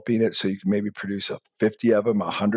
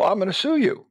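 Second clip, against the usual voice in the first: much higher, indignant threat; mock threat; 11 semitones higher than usual. Wronged inventor threatening the copier, mock indignant. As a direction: threaten to sue, high-pitched.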